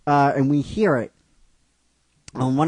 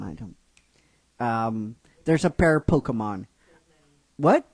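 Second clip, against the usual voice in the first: ordinary delivery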